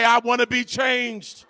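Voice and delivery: high, full-force declaration for the crowd to echo; preached line to repeat. Shown as a demonstration. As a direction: lead the church in the phrase. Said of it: "high five their neighbor and say".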